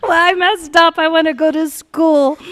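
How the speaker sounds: high, loud comic lament; hammed-up pleading regret; mock wailing. Teller as overwhelmed pregnant teen wanting out, loud mock wail. Teen begs to escape the birth.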